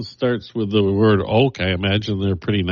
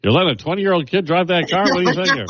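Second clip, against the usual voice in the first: imitating insurance agent; 10 semitones higher, faster than usual. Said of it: "will see the video and go".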